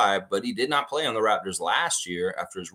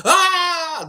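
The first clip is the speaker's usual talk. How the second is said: high, loud laughing shriek; hammed-up scream with a laugh; mock horror scream; 9 dB louder than usual. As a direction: fake a horror-movie scream for fun.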